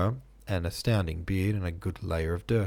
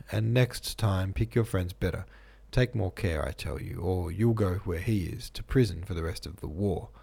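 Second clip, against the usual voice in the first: plain reading voice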